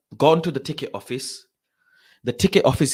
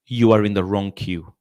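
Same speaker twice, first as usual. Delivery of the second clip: firm, lower and louder announcement; mildly voiced official; 5 semitones lower, 6 dB louder than usual. Official tells fan he is in wrong queue, firm. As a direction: lightly imitate an official laying down the rule.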